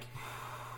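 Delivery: unvoiced sigh of shock; stunned sigh; long shaky sigh. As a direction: breathe out in disbelief. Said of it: "I was like".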